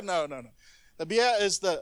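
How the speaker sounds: usual speaking voice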